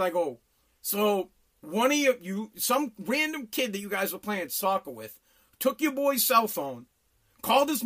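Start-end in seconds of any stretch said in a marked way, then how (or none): none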